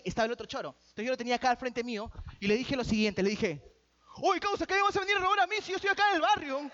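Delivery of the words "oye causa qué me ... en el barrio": mock street authority. Preacher acting out facing down his mugger, mock street authority. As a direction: confront the robber like a neighbourhood tough guy. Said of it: "se lo dije con autoridad".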